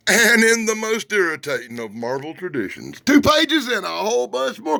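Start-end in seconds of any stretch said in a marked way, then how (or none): none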